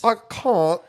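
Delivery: high-pitched, put-on British "can't"; hammed-up British accent with a broad vowel; mock British accent. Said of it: "You can't do a British accent".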